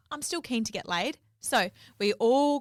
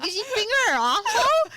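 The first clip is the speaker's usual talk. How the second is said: much higher and louder, brash; drunk blurt; 12 semitones higher, 7 dB louder than usual. Retold or imitated. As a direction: repeat it louder, shameless and tipsy. Drunk friend repeating louder.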